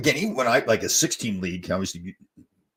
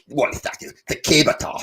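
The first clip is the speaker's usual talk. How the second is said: higher-pitched mock Scottish accent; attempted Scottish accent; 7 semitones higher, 5 dB louder than usual. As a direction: have a playful go at sounding Scottish. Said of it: "Scottish accent".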